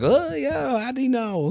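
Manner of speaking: high-pitched mock crowd voice; put-on high voice of the crowd; mimicked crowd reaction. Imitates the crowd's reaction on stage.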